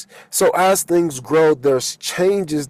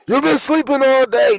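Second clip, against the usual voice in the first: nagging waker; 9 semitones higher than usual. Acted. Acted quote waking a sleeper.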